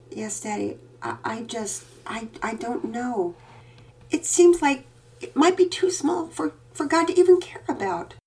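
put-on high, halting child's voice; hesitant little girl. A narrator's reading. Daughter confessing her doubt to her father. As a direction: stammer out a small doubt.

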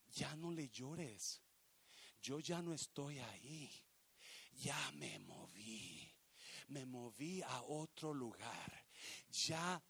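very soft, low and slow; near-murmured, with long pauses; God's hushed voice. Acted. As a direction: speak as God gently letting someone go. God speaks gently to Samuel.

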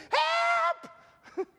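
high squealing yell of Help; shouted cry for help. Shown as a demonstration. Preacher acting Peter's cry in the storm, high yell. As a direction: scream it high like you're going under. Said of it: "cry what you feel".

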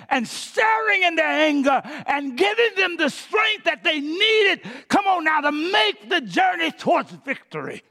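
high, shouted, climactic sermon peak; full-force preaching. Real, not acted.

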